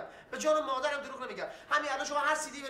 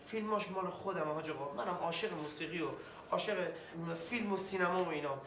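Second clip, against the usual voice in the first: quiet pleading; 7 semitones lower, 6 dB quieter than usual. Acted. Pleading with interrogator.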